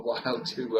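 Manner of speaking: ordinary talk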